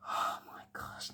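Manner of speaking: hushed whisper under the breath. Real, not acted. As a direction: whisper it so the caller can't hear. Aside to viewers during call.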